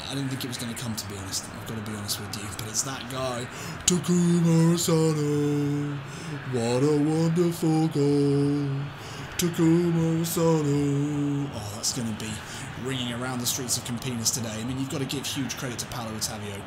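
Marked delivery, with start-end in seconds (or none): 3.9-11.5 elated slow hailing; drawn-out celebration. Real, not acted. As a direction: savour the scorer's name, stretching it. Savouring the goalscorer.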